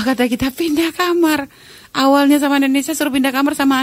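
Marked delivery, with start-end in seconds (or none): 0.5-1.5 slow, disbelieving repeat, a little higher; stunned echo. Retold or imitated. [name] echoes the friend's order in slow disbelief. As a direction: repeat the order slowly, half in shock.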